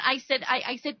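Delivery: ordinary talk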